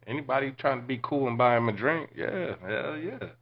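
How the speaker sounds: acted gleeful yes; enthusiastic. Acting out delight at free drink.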